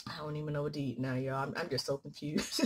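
low, soft, fast mumble; soft confused mumble. Retold or imitated. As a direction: mutter helplessly to yourself. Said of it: "sit there and be like".

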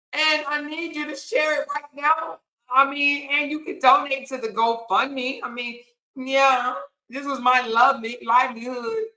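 high, whiny pleading voice with shouted bits; mock begging plea. To viewers, mocking Jerron begging for shares, high whiny plea. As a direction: ham up a fake crying beggar. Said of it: "act like he was damn crying, begging".

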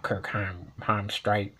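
ordinary delivery

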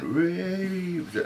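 high, drawn-out mimicked cry; imitated woman's call. Investigator copies the woman heard on the session, imitated high call. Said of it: "the woman went".

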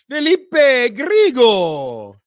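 loud, high, long-drawn name call; announcer-style holler. Real, not acted. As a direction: belt out the name, stretching it. Calls out viewer's name theatrically.

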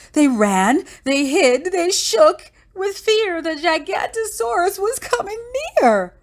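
high, loud, panicky narration; urgent panic. A narrator's reading. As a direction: push the scare to its peak. Story tension peaks as monster nears.

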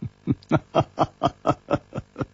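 unvoiced scornful laugh from the shadows; a short contemptuous laugh of breath; cold breathy laugh. A narrator's reading. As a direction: hidden stranger's cold laugh. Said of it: "冷笑着说".

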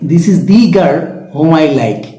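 slow sentence with "the" as a stressed "dee"; emphatic "thee". Shown as a demonstration. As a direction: draw out "the" before "girl" as "dee". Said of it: "গুরুত্ব দেওয়া".

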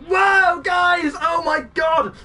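blown away, shouting it out high; thrilled shout, easing slightly but staying high; astonished excitement. Real, not acted. Surprise raid arrives on stream.

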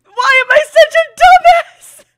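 very loud, high tearful shout; self-berating wail. Real, not acted. Streamer berating self to viewers, loud anguished shout. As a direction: wail at your own stupidity.

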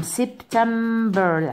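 "سبتمبر" drawn out slowly; slow model word. Shown as a demonstration. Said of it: "عادي، سبتمبر".

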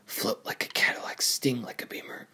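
soft, unvoiced-sounding whisper; whispered pep talk. Racer hyping himself up to float and sting, whispered. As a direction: whisper it to yourself like a mantra.

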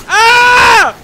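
high, full-volume mock death scream; terrified scream. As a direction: scream as if being eaten. Being eaten in game.

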